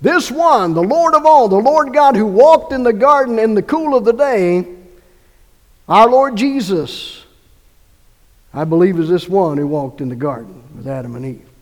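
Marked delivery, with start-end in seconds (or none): 0.0-7.2 louder, higher ringing sermon voice; preaching at full force. Acted. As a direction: build it up like a preacher at the peak. Preaching climax.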